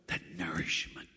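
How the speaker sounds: whispered, low That nourishment. Real, not acted. Preacher whispering the word to the congregation. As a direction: breathe it as a whisper.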